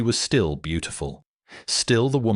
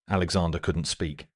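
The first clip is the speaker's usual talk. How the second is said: usual speaking voice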